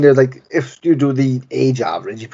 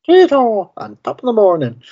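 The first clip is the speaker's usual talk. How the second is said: much higher, hammed-up stage-Irish greeting; mock Irish accent; 10 semitones higher, 4 dB louder than usual. Joking to his co-host about Sean's Irish roots, in a hammed-up Irish accent. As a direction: play a cartoon Irishman, loud and silly.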